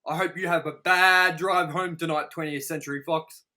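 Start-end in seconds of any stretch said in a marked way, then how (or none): none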